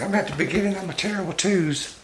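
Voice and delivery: put-on very high toddler-monkey voice; high impish pet voice. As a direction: squeak like a cheeky little monkey. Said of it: "He's like".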